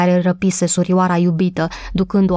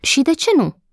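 a mildly grouchy retort; a bit grumpy; 8 semitones higher than usual. A narrator's reading. Old gardener answers the maid, mildly grumpy. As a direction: snap back lightly, irritated. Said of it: "cam arțăgos".

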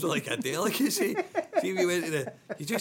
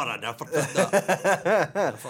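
slow, laughing, exaggerated innocent denial; mock denial; 7 dB louder, much slower than usual. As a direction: protest innocence for the mates listening. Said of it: "If they're listening, ever".

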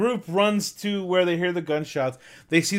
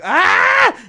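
high loud mock shriek; mock scream; 8 dB louder than usual. Retelling the old woman's startled scream, high. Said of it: "old woman's just like".

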